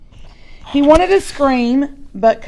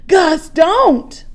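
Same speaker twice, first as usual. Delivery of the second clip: urgent plea; 7 semitones higher, 4 dB louder than usual. Acted. Boy pulling brother back.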